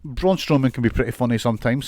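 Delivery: ordinary talk, nothing marked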